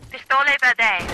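Italian attempt. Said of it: "I don't know what I'm saying anymore".

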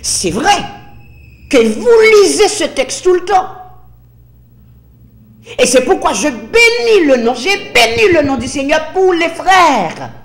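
loud, high, insistent sermon voice; full-force preaching. Real, not acted. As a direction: hammer the questions at the congregation.

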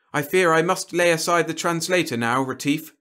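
a touch of courtly reluctance; regretful alien. A narrator's reading.

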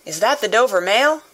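lightly called-out question; calling out. A narrator's reading. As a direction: hail someone from a distance. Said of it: "a man's voice called from the mist".

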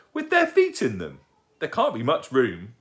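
loud, high squeal of disbelief; astonished. Acted. Young owl puzzling over toys in worn stockings, astonished. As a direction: squeak in amazement, easing down on the second sentence.

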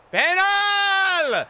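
high, loud held shout; shouting at the ref; loud appeal. Real, not acted. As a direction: yell for the penalty. Appealing for a penalty.

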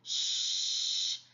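"ch" as a long voiceless shhh; isolated ch sound. Shown as a demonstration. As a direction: hold "ch" softly, with no vowel. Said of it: "donnent le son". (« ch » soufflé et prolongé, sans voyelle)

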